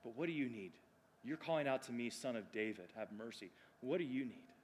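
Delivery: soft, low, tender acted appeal; gentle questioning. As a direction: ask quietly, as if one to one. Voices Jesus gently asking the beggar.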